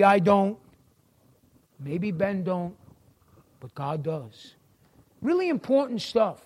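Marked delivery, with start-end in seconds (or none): 1.8-4.5 very soft, low and hushed. Acted. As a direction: drop to a near whisper. Preacher drops voice for effect.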